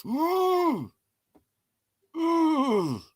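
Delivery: squeaky whimpering ooh; high frustrated wail. Real, not acted. Learner whimpering after failing the word. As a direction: whimper in defeat. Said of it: "Never mind knowing".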